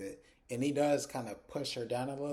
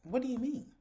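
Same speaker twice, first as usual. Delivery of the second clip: much higher, baffled question; a high, incredulous what do you mean; exasperated disbelief; 12 semitones higher than usual. Real, not acted. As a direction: throw it back in disbelief. Her own exasperated reaction.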